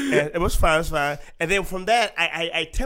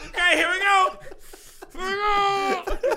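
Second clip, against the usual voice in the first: mock nervous; 14 semitones higher than usual. Bracing, high, laughing.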